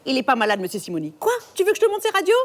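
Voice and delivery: hammed-up indignation, leaping very high; outraged mother. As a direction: retort like a scandalised mother in a comedy. Acted film quarrel, mother retorts. (mère outrée, voix qui grimpe dans l'aigu)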